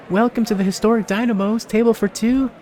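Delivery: high, bright forced-cheer greeting; fake cheerful waitress. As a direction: plastic customer-service smile voice. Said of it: "A very tired waitress puts a plastic smile".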